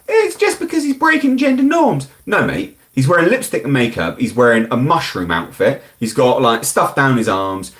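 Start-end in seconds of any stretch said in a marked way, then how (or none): 0.1-2.1 very high-pitched mock apologist voice; mocking imitation. Streamer mimicking people who excuse the actor, mocking. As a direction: squeal out a silly caricature of excuse-makers. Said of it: "And people are like".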